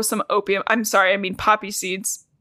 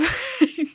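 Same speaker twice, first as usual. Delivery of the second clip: high, breaking laugh; teary laugh. Real, not acted. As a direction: laugh through tears. Laughing at own tears.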